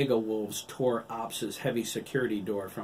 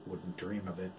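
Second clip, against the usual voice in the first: softer, dry mutter; 2 semitones lower, 6 dB quieter than usual. A narrator's reading. Doctor yields to the man with the gun, muttering. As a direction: mutter it under your breath. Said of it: "she muttered".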